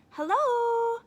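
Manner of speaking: high, loud friendly hello; sudden bright greeting; cheery greeting. Acted. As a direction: startle the robber with a cheery hello. Uncle greets robber.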